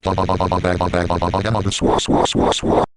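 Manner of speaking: monotone stutter of "fa" ending in swooshes; fa glitch. Shown as a demonstration. Second of two examples, monotone fa glitch into swooshes. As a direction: hold one pitch, machine-gun "fa", then airy "swa". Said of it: "Here are two examples".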